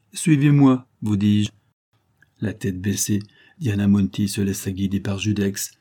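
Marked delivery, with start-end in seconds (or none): 0.1-1.5 loud, high order falling at the end; insistent command. A narrator's reading. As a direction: repeat the order, brooking no refusal.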